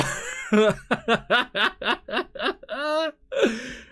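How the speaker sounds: long high-pitched cackle; helpless, drawn-out laughing; real laughing fit. Real, not acted. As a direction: dissolve into wheezy laughter. Cracks up again.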